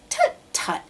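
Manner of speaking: very high tutting bird; dismissive tut. Acted. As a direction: brush his worry off with a high tut. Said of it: "answered Maisie".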